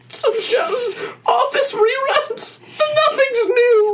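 high, slow crying wail. Acted. Boy wails about TV lineup.

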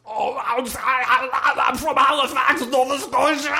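high, loud dying cry; dramatic shout. Retold or imitated. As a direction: cry it out as he dies. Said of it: "He goes".

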